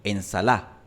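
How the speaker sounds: ensalá with the d gone, stress on the final á; dropped d. Shown as a demonstration. Teacher demonstrating the dropped d in ensalá. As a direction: drop the d entirely: ensalá. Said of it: "puede desaparecer por completo".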